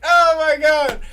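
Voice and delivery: retold shocked yell; loud oh my God. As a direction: yell it in disbelief. Retold embarrassed reaction.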